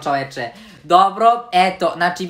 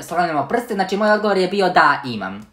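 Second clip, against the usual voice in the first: usual speaking voice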